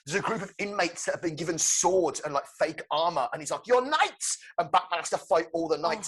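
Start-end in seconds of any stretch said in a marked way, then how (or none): 3.7-4.4 high, raised herald's cry; theatrical Joker proclamation. Acted. Joker sets armoured inmates on Batman, theatrical proclamation. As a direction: proclaim it like a mad jester.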